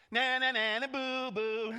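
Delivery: high, loud singsong taunt; mock them in a loud playground chant; sneering sing-song jeer. Slave girl taunting Paul and Silas.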